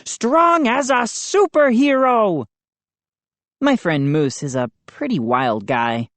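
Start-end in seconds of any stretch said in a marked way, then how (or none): 0.0-2.5 high boastful superhero voice; hammed-up bellow. A narrator's reading. High showy boast. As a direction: Moose brags about his strength, boastful. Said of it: "he bellowed".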